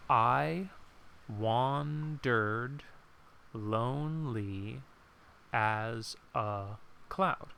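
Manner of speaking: reading the line slowly, syllable by syllable; slow counted reading. Shown as a demonstration. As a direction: count each beat out, slow and deliberate. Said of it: "So let's count".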